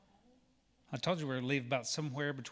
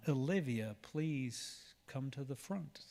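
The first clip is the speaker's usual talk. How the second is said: ordinary talk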